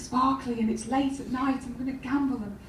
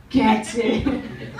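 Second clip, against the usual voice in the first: imitated catchphrase; 4 dB louder than usual. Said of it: "Runs herself a bath".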